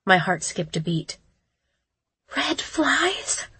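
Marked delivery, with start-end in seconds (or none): 2.3-3.5 fearful breathless whisper. Acted. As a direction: whisper the question in dread. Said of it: "I whispered".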